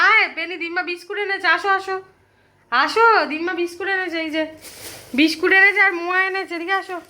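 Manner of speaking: high-pitched calling to a pet; excited coaxing. Real, not acted. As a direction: coax the dog over with treats, high and eager. Calling the dog with treats.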